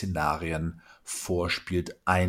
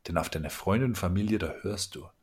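normal talking voice

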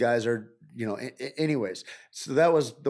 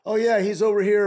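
higher, louder casual call; helpful crew member; 8 semitones higher, 6 dB louder than usual. Retold or imitated. Crew member directing a newcomer to the coordinator, raised helpful call. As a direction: play a crew member calling it over.

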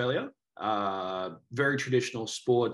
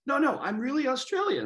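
insisting. Retold or imitated. Guest retelling his protest to the girls, high and insistent. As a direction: pitch jumps high in mock protest. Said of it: "And I was like".

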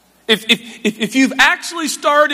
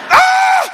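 high, loud release of held breath; comic high-pitched scream; bursting yell; 5 dB louder than usual. Acted. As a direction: let the held breath explode in a cry.

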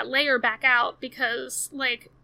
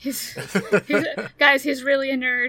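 real laughter breaking through the words, louder; laughing; 6 dB louder than usual. Real, not acted. Laughing about cohost being nerd.

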